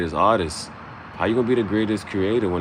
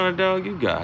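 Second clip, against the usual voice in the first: woman's voice; 12 semitones higher, faster than usual. Retold or imitated. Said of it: "She said".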